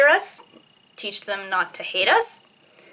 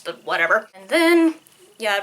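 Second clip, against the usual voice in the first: ordinary talk, nothing marked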